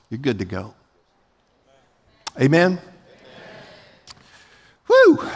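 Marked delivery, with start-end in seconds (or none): 4.9-5.3 very high, loud "woo"; loud whoop. Real, not acted. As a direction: let out a high-pitched whoop. Preacher lets off steam after yelling.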